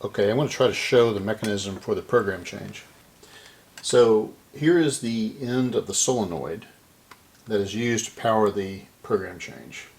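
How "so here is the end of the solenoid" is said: usual speaking voice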